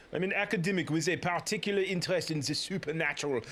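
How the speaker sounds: put-on French accent. Toussaint introducing himself to the investigator, French accent. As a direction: parade a bad French accent. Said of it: "an accent parade".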